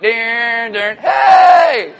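drawn-out fanfare into a high shouted hey; showy entrance flourish. Acted. Imagining Jesus greeting the women, hammed-up fanfare. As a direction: ham up a big ta-da entrance.

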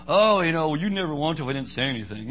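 mock passive-aggressive grumble, rushed at the end. Preacher imitates a spouse dodging a kiss, passive-aggressive. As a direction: imitate a spouse sulking and making excuses. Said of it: "the passive aggressive".

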